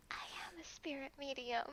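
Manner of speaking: unvoiced, soft confiding whisper. Maya whispering her secret to Edgeworth. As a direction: breathe it as a secret.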